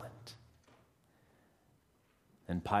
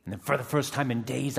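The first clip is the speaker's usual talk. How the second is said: normal talking voice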